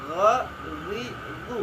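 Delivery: throaty gh on "Gha", then "ri, ru"; ghin letter demo. Shown as a demonstration. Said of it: "ghin sama huruf kha". (huruf ghin diucapkan dari pangkal tenggorokan)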